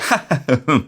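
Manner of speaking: sinister cackle; a dark, gleeful villain laugh; evil villain laugh. Pretend. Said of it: "I can imagine your laugh going".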